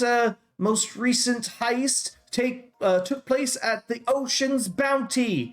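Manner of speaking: high, slow carrying crier's call; high-pitched crier reading out a bounty notice; town crier announcing. Acted. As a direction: proclaim the wanted list to the crowd. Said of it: "you hear him yelling".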